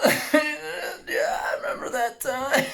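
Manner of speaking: high, loud laughing through the words; cracking up almost to tears; real laughter mid-story; laughing hard. Real, not acted. Laughing while recalling.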